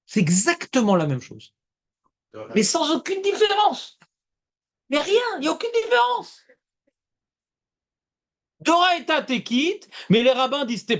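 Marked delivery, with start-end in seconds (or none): 2.5-6.4 insist, high-voiced, to someone who won't get it; high, emphatic protest; exasperated insistence. Real, not acted. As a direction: pitch leaping high in real exasperation. Insisting to students who don't follow.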